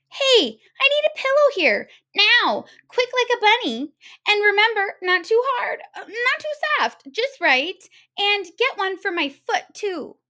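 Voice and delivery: a pushy guest barking orders at her hosts; bossy spoiled big girl. Acted. The big little girl bossing the hares for pillows, high and loud.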